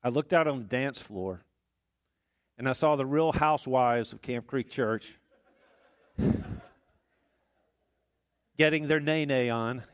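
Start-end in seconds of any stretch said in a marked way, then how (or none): 2.6-9.8 amused. Real, not acted. Amused wedding anecdote, laughs heard.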